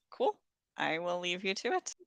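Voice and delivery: usual speaking voice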